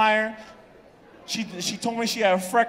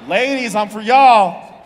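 loud, slow call out to the ladies; shouting to the crowd; 8 dB louder, slower than usual. Real, not acted. Comic shouting out to the ladies in the audience. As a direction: belt it out to the women in the crowd.

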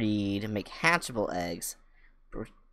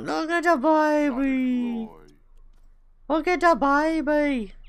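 much higher, slow cooing; 6 dB louder, slower than usual. Real, not acted. Cooing at baby animal.

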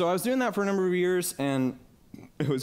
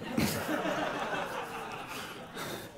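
soft laugh; 5 semitones higher, 8 dB quieter than usual. Real, not acted. Laughing about wife's reminders.

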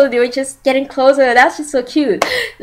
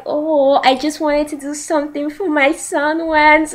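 soft, higher-pitched motherly confession; tender mom; 5 semitones higher, 7 dB quieter than usual. Retold or imitated. Mom explains herself to her son, soft imitated tenderness. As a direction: soften and lift it, imitating a doting mother.

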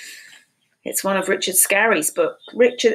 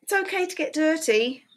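bright, high storybook read; sing-song read-aloud for little kids; reading to children; 8 semitones higher than usual. A narrator's reading. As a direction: read it like a cheerful picture book. Reading picture book aloud.

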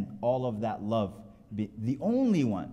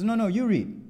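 high, louder mock protest; exasperated insistence; 11 semitones higher, 6 dB louder than usual. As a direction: imitate a student refusing, pitch leaping up. Student's escalating refusal, retold.

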